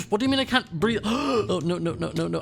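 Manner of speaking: sudden gasping panic; panicked. Real, not acted. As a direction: cry out as the air runs out. Realising she can't breathe on land.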